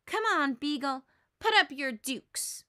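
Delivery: a touch of mock toughness; picking a fight. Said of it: "ready for a fight".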